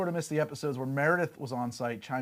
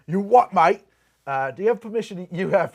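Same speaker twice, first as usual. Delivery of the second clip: loud, high mock challenge; playfully belligerent, voice raised; mock-aggressive; 7 semitones higher, 8 dB louder than usual. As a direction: square up, jokingly confrontational. Mock-aggressive use of British slang.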